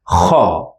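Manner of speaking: kha rasped from the back of the throat; like a snore, but from the throat; throat kha sound. Shown as a demonstration. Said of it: "we pronounce it as".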